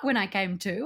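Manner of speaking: normal talking voice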